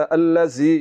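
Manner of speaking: hissy z in place of dh in "अल्लज़ी"; whistling za. Shown as a demonstration. Teacher showing students the mistake, buzzing whistled z. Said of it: "ये गलत है".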